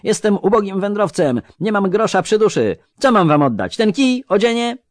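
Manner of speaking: loud pleading, pitched far up; high, yelled plea. A narrator's reading. Poor traveller pleading with robbers, yelling high. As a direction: protest loudly in a high, pressured voice. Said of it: "wrzasnął co sił w płucach".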